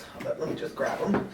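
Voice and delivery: breathy aside, no voice; whispering. Real, not acted. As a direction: whisper it to yourself. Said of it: "repeats it under breath".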